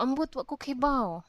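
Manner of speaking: quick exasperated. Shown as a demonstration.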